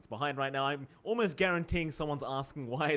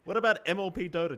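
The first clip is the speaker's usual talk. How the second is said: mock indignant; 7 semitones higher, 7 dB louder than usual. Mock jealous outburst.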